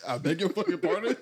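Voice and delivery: offended disbelief. Real, not acted. Offended reaction, laughing.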